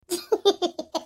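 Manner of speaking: short, tittering giggle; snickering laugh. Acted. Onlooker reacts to the leg mishap, snickering.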